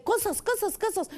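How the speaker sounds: high, eager chant of stories; excited kids. Pretend. Children begging the teacher for stories, eager high calls.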